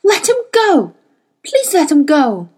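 high, loud desperate plea; pleading cry, pitched high and falling; anguished cry. A narrator's reading. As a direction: beg frantically for mercy. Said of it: "she cried".

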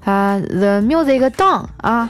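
slow, stumbling English with fillers; halting English. Retold or imitated. Asking a foreigner to turn down music, halting English. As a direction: grope slowly for each English word. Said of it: "用磕磕绊绊的英语啊对他说".

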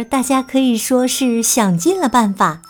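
usual speaking voice

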